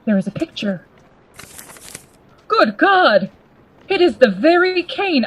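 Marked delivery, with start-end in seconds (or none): 2.5-5.2 sudden high exclamation of alarm; shocked. Acted. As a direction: recoil at the sight. Shock at seeing the cane.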